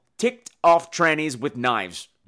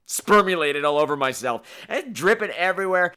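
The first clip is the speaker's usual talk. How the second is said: ordinary talk, nothing marked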